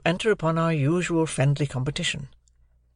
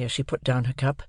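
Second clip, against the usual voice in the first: plain reading voice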